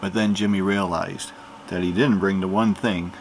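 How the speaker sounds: plain reading voice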